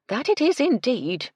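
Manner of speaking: high, loud, hearty agreement; emphatic housekeeper. Acted. Housekeeper agrees with her mistress about love, emphatically. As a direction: agree emphatically, pitched well up.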